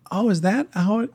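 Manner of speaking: "Oh, is that how it" with a high questioning lift. Shown as a demonstration. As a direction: turn it into a curious question. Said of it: "I'll try to say it as a question".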